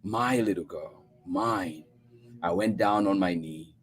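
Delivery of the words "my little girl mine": usual speaking voice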